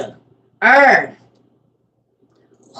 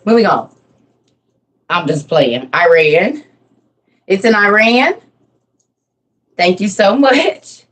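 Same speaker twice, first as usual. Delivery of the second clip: ordinary delivery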